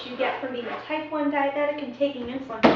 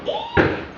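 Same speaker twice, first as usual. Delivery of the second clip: very high squealing eek; startled squeak; 4 dB louder than usual. Pretend. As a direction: squeal in fright.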